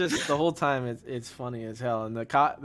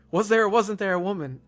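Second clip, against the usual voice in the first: higher, louder, pressing question; cop asking again; 7 semitones higher, 5 dB louder than usual. Retold or imitated. Cop faces the deaf man and asks again, a touch louder. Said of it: "he's like".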